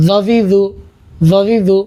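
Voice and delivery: জজিজু with the tongue between the teeth. Shown as a demonstration. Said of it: "জিহ্বাটা একটু বের করে নেব".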